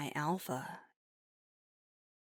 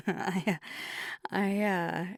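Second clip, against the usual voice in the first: flustered laugh; 3 semitones higher, 4 dB louder than usual. Acted. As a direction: stumble over your words through an embarrassed laugh. Flustered reply to an unheard remark.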